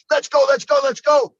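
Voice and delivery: loud, eager let's go, rushing ahead; itching to go before the fight, loud and restless; too fired up. Retold or imitated. Fighter too fired up before the bout, loud impatient urging. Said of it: "I'm too excited".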